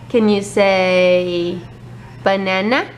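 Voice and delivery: put-on very slow, drawn-out toddler coaxing; reading to a toddler. Shown as a demonstration. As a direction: stretch each word for the baby. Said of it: "teaching toddler a word".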